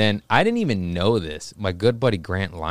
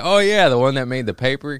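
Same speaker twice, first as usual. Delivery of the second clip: higher, louder retold exclamation; amused recognition; 5 semitones higher, 5 dB louder than usual. Cop buddy recalls the fireworks story, retold amused recognition. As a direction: imitate a friend's amused recall, pitched up, no real laugh. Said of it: "then he starts laughing".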